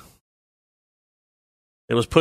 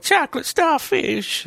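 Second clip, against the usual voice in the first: much higher and slow mock singer call; slower than usual. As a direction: croon it out squeaky and slow. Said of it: "[name] on your phone".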